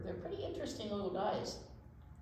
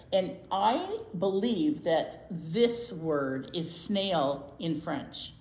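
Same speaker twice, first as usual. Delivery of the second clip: slow, deliberate build to the French word; teacherly slow reveal; 2 semitones lower, 6 dB louder, much slower than usual. Shown as a demonstration. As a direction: drag it out for suspense. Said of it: "This is a different letter".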